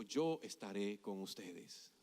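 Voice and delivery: low, slow, soft divine promise; voice of God. Retold or imitated. God promising Moses His presence, low and solemn. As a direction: answer solemnly, deep and unhurried.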